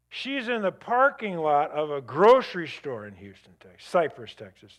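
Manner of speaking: usual speaking voice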